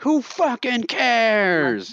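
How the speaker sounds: high, drawn-out defiant holler. Retold or imitated. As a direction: draw it out in a high mock yell. Mocking a friend's loud retort.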